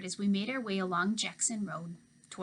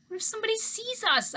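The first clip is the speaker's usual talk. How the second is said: yelled much higher and louder across the street; hollered; 13 semitones higher, 5 dB louder than usual. Acted. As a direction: holler it after Mama running off. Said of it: "I hollered out the window".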